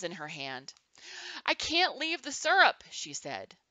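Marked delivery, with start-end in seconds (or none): 1.5-2.8 high-pitched protest from a storybook grandma; high, lively character voice for Grandma. A narrator's reading. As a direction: voice Grandma's objection, pitched well up. Grandma protests mid-party, cheeks pink.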